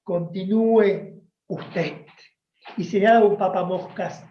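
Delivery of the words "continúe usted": stern teacher. Retold or imitated. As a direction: stop the reader dead, then point. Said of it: "la señorita decía". (maestra cortante y luego lenta)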